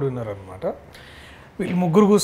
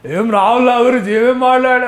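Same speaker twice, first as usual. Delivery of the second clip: loud, high put-on character voice; imitating Jeevan; 7 semitones higher, 7 dB louder than usual. Host mimics his friend demanding what was said, loud put-on voice. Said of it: "ఆ గొంతు కూడా ఎట్లా మాట్లాడుతుంది".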